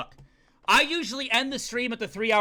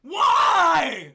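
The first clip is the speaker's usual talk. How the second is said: repeated loud cries of disbelief; anguished shouts; 4 semitones higher, 6 dB louder, much slower than usual. Real, not acted. Streamer shouting at the game's betrayal, outraged shouting. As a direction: yell it out at the screen in outrage. Game twist shocks streamer.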